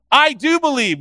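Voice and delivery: loud, high declaration of faith; crying out. Retold or imitated. Father answering Jesus about his son, crying out. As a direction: cry it out as the desperate father. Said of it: "he instantly cried out".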